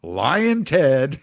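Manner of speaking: high, loud, drawn-out name-calling; mock a politician taunting rivals with nicknames; Donald Trump. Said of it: "It wasn't Trump just going".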